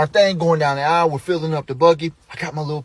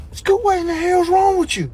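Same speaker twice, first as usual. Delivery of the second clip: high, strained exclamation; dad's squealed disbelief; shocked outburst. Retold or imitated. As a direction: demand it in startled outrage. Doll thrown at dad.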